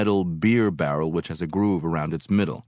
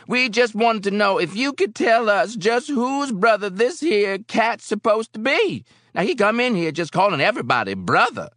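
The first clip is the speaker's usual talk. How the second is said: high, loud, accusing challenge; belligerent bar patron; 7 dB louder than usual. A narrator's reading. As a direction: demand an answer, loud and pitched high.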